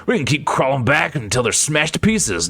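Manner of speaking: ordinary talk, nothing marked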